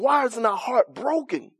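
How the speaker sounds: usual speaking voice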